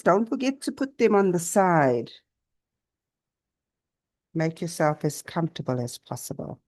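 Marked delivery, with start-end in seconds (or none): 4.3-6.6 unhurried yoga nidra voice with long pauses; guided meditation. Real, not acted. Teacher guiding listeners to notice body and breath, calm meditation. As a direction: guide them gently through the body, leaving space after each phrase.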